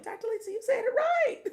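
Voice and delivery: high, loud mock cheer from a viewer; high, loud imitation of a fan cheering her on; excited viewer. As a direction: squeal it like a thrilled fan. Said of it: "Every now and then somebody be like".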